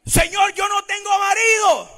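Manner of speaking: high, loud acted denial; protesting Samaritan woman. Woman insisting to the Lord she has no husband, emphatic. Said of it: "le dice la mujer".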